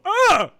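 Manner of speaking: very loud, high mock scream; dazzled shriek. Passers-by shriek, very loud and high, at his looks. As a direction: shriek like you're blinded. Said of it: "people go".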